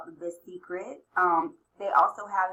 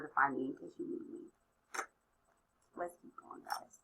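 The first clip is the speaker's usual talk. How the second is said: whispered aside; 5 semitones lower, 10 dB quieter than usual. Real, not acted. Aside to someone off mic.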